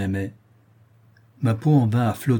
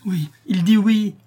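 much higher, louder and slow, triumphant; delighted affirmation; 10 semitones higher, 5 dB louder than usual. A narrator's reading. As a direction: announce it with joyful certainty. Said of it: "affirma ma douce maîtresse".

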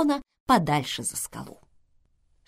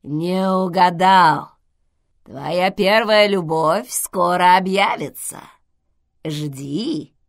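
slow, loud creaky intercom voice; скрипучий голос; 7 dB louder, slower than usual. A narrator's reading. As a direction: creak it out slowly and loudly. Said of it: "скрипучий голос". (скрипучим голосом, громко и медленно)

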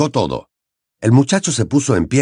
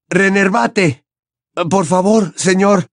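higher desperate plea; pleading spell; 9 semitones higher, slower than usual. Acted. Harry pleading with Dumbledore, desperate. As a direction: beg through the spell.

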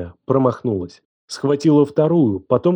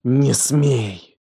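tight, slow hissed warning; hissing. A narrator's reading. Елена warns him after the slap, hissing. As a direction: hiss it through contained rage. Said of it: "прошипела она".

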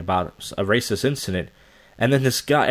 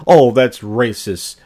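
louder, very slow mock scandal; mock prim outrage; 6 dB louder, much slower than usual. Mocking the frozen-faced old woman, slow prim outrage. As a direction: ham up an offended old lady. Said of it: "She's like".

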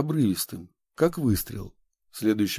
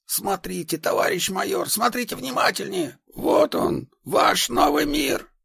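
manic excitement; 12 semitones higher than usual. Acted. Madman points at empty yard.

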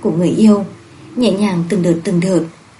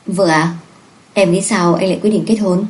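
plain reading voice

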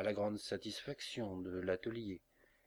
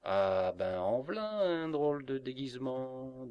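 amazed cabman; 4 semitones higher, 4 dB louder than usual. A narrator's reading. Coachman seeing a bear climb into his cab, amazed cabman. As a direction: open loudly, then let the pitch swoop up.

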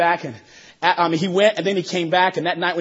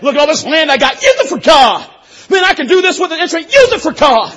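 shouted, high-pitched preaching with bursts of laughter; fiery preaching; 9 semitones higher, 9 dB louder than usual. Acted. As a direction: preach it hard to the whole house. Preaching at full force.

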